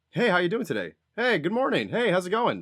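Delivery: polite code-switched phone voice for strangers. Shown as a demonstration. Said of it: "that's the voice you're gonna get".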